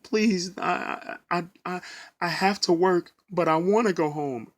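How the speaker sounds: choked, stuttering mock sobbing plea; retold crying plea. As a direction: beg through tears, half-acted. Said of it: "So I'm crying and I said".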